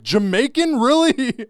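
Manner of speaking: high, loud disbelief; incredulous. Retold or imitated. Comic reacting to being called Jamaican, loud disbelief. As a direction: stretch it out in mock shock. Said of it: "And I was like".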